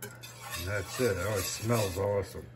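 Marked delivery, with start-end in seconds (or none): none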